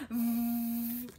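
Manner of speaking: hummed "Mm" through the nose; nose sound. Shown as a demonstration. Teacher models the nasal m for kids. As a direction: hum it. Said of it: "Another nose sound".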